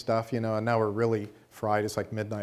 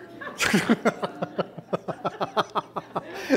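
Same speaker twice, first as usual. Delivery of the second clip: breathy laugh; 9 semitones higher than usual. Real, not acted. Laughs at the printer story.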